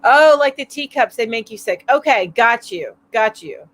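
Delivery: ordinary talk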